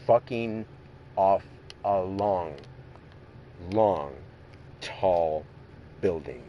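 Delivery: "off", "long", "tall", "building" each set apart by a pause; pausing for emphasis. Real, not acted. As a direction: drop "tall building" low, word by separate word. Emphatic advice to a bully.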